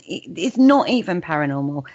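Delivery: ordinary delivery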